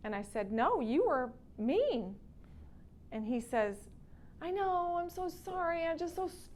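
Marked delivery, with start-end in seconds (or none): 0.5-2.2 drawn-out, swooping mock scolding; teasing retort. Acting out her teasing reply to husband. 4.4-6.5 high-pitched imitation of a sorry husband; mock plaintive apology. Acting out husband's sorry, frustrated reply.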